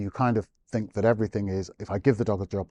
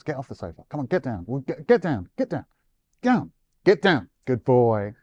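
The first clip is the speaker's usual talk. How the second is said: repeated commands climbing higher and louder to a pleading yell; owner nagging dog; 4 semitones higher, 4 dB louder than usual. Retold or imitated. Owner to dog on the sofa, a rising nagging plea. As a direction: mimic an owner begging the dog to get down, rising.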